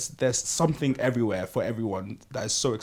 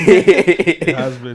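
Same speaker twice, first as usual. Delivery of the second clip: laughing; 4 semitones higher, 11 dB louder, much slower than usual. Real, not acted. Laughing agreement, drawn out.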